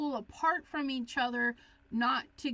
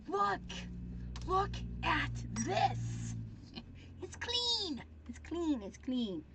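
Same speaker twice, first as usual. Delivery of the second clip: high-pitched, delighted reveal; excited showing-off; 4 semitones higher, slower than usual. Real, not acted. Crafter showing viewers the clean stencil, high and excited. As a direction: show it off in a high, thrilled voice.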